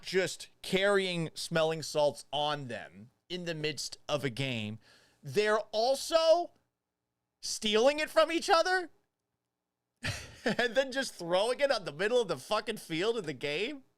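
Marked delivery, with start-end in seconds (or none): 5.2-11.9 mock disbelief. Incredulous about stolen salts.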